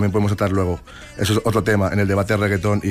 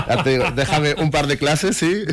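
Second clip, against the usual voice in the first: laughing reassurance, pitched higher; 6 semitones higher, slower than usual. Real, not acted. Dancer offers host a few classes, laughing. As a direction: offer it slowly through real laughter. Amused by host's self-doubt.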